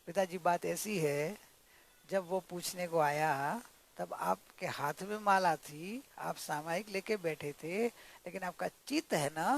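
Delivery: low, soft, careful explaining; humble, cool. Acted. Daughter-in-law explains to father-in-law about his mind, gently. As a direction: break the truth gently and respectfully. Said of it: "विनय से और एकदम ठंडा करके सुनाना है".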